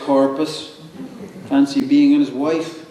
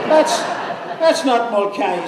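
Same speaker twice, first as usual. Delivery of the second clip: put-on drunk voice; 11 semitones higher than usual. Caretaker retelling the drunk at the grave, higher drunk voice. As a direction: play the drunk squinting at the statue, higher. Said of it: "says he".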